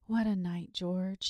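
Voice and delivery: ordinary delivery, nothing marked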